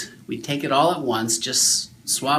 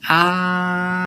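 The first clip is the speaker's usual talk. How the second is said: put-on a higher open "Ah" to show the child; saying ahh; 5 semitones higher than usual. Shown as a demonstration. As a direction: model a wide open ah. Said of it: "open real wide and say".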